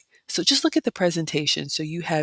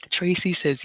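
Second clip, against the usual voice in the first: ordinary talk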